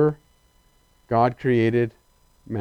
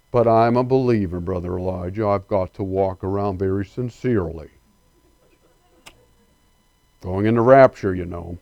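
mock solemn believer; 8 semitones lower than usual. Preacher mocks joyless believers, in a dull solemn voice. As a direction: play the believer as dutiful and joyless, a little sarcastic.

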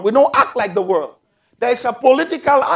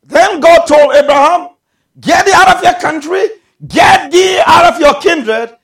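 loud, raised scripture proclamation; full-force preaching; 5 semitones higher, 9 dB louder than usual. Real, not acted. Preacher retelling God's command to Abraham, full-force preaching. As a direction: ring out the command from the pulpit.

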